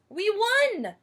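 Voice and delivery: high excited cry; cheering boys. Acted. Boys cheering the win, excited shout. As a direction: celebrate out loud. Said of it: "shouted the boys".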